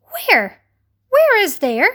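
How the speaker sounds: high, insistent questioning; asking. A narrator's reading. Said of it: "they asked".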